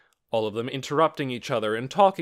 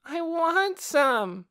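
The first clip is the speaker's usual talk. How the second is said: put-on high, slow whining child; whiny little girl; 4 dB louder, much slower than usual. A narrator's reading. Little girl tugging a shirt tail, whining. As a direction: stretch out the want like a nagging child. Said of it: "she cried".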